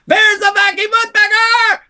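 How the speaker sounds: wild high yelled announcement; excited shout. Retold or imitated. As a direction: burst in shouting like a terror. Bursting into the agency yelling.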